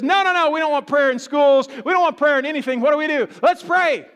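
high, loud imitation of protesters; mock outcry. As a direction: build from indignant objection to an urgent shout. Mimics pagans objecting, then praying.

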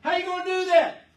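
put-on loud, high jeering at the old fogey; mocking scoffers. Noah's neighbours jeering at his boat, loud high mockery. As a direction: sneer and shout like the crowd ridiculing Noah. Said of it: "They mocked, they scoffed".

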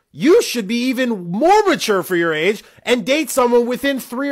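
heated, high-pitched scolding; getting angry. Real, not acted. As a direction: lay into them, voice climbing and emphatic. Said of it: "I don't know why I'm getting angry".

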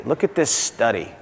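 usual speaking voice

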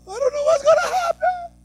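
very high, loud, frantic worrying; panicked worrier. Retold or imitated. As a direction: fret in a high panicked squeal. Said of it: "and you're like".

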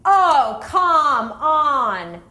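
annoyed groan. Retold or imitated. Passenger two rows up complaining, loud exasperated groan. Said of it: "some dude two rows up goes".

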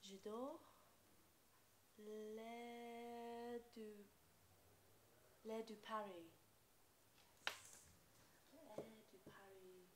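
sultry perfume ad. Acted. Perfume ad takes on set.

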